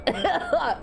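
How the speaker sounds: high nervous giggle; a quick squirmy laugh. Real, not acted. Reading a gross scene aloud, nervous high giggle.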